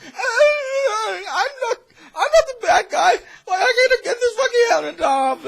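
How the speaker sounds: very high, loud, fast panicked yell; panicked flight. Retold or imitated. The fleeing man yells to the guards, fast shrill panic. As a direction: shriek it while running, half laughing. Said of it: "he, like, runs away, like".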